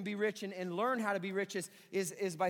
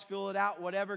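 ordinary delivery

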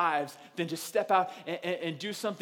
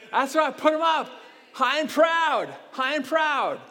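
loud, high call to the crowd; rallying shout; 9 semitones higher, 8 dB louder than usual. Real, not acted. Pastor urging raised hands, loud rallying shout. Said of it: "calling congregation to raise hands".

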